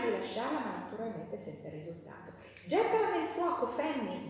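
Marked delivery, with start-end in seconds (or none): 2.7-4.3 high, loud bratty yell; a cheeky child's high shout; boy shouting. Acted. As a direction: shout it like a spoiled boy egging his sister on. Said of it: "gridò Tom".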